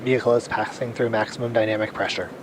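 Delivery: quick radio call from flight control; mission control radio. Real, not acted. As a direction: call it out over the comms loop. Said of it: "we just heard that call out".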